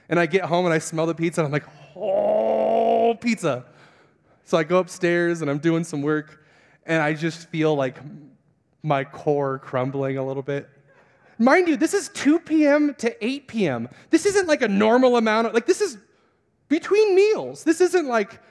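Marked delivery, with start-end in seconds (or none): 1.9-3.6 long, high drawn-out moan of longing; hammed-up food lust; swooning craving. Fasting man smells pizza at home, swooning. 11.4-18.4 high, loud comic exasperation; incredulous emphasis. Real, not acted. Preacher mocks his own short fast, incredulous. Mock outrage at his weakness.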